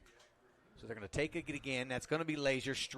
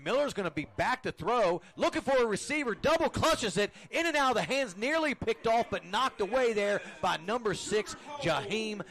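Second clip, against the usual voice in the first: rising excited play-by-play, higher and louder; esports caster; 7 semitones higher, 6 dB louder than usual. Acted. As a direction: call the play fast and loud as it unfolds. Live call of fourth down play.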